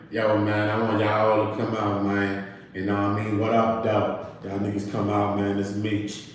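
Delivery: low, soft imitation of a man on the couch; Big Meech. Meech asking everyone to come out, low impression. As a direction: drop the pitch and mimic his laid-back call-out. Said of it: "he was sitting down like this".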